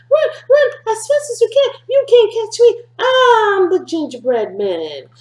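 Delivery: high, loud singsong taunt; the gingerbread man mocks everyone chasing him, in a high, loud singsong. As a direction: tease the chasers in a high cheeky voice. Repeating the taunt for children.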